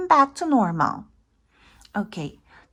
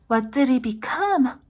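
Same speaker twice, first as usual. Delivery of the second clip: ordinary delivery, nothing marked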